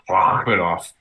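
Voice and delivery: low Russian accent on Rachmanov. Shown as a demonstration. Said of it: "getting my throat ready to speak like a Russian".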